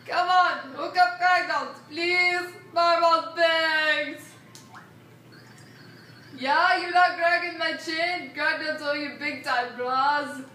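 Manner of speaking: loud pleading brute; loud caveman voice. Acted. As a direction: bellow it like a caveman. Kragnon begging loudly.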